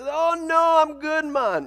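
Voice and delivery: high, hammed-up Jamaican-style accent; friendly, high-pitched imitation Jamaican accent; put-on island accent, Jamaican-like. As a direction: wave off an offer in a mock Jamaican lilt. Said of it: "or that's Jamaican".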